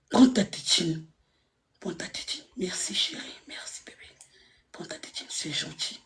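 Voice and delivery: soft unvoiced baby-talk whisper.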